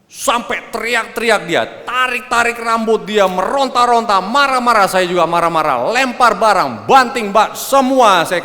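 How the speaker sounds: loud, high, breathless-paced list of mayhem; heated fight retelling. Retold or imitated. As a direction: re-enact a screaming row at full pitch. Retelling a violent marital fight.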